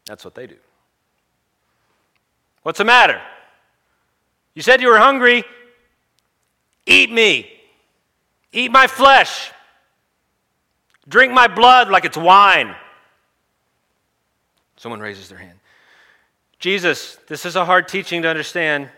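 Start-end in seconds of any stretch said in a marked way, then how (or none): 2.6-12.8 high, full-volume goading yell; challenging shout. Acted. Jesus confronting a crowd that balks, challenging shout. 16.6-18.9 raised, high protesting voice; calling out objection. Acted. Someone raises a hand to object, calling out.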